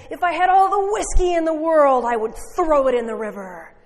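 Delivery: booming, high, all-out preacher shout; rafter-shaking finish. Retold or imitated. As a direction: shake the rafters with it. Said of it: "Big Finish shaking the rafters".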